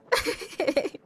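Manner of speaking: high-pitched embarrassed giggle. Real, not acted. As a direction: burst into a squeaky giggle. Laughs at own joke.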